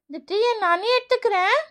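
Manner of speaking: ordinary delivery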